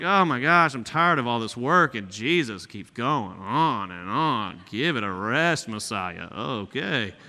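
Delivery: low, slow, sour grumble; mean, grumbling. Pretend. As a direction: sulk like someone fed up with it all. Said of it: "this mean, terrible, shallow person".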